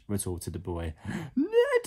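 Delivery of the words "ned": very high, loud furious yell; high screech of outrage; screamed. A narrator's reading. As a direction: scream the boy's name. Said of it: "she screamed".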